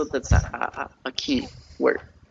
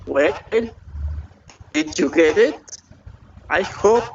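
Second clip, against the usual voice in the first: ordinary talk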